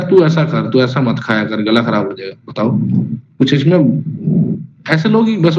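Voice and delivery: ordinary delivery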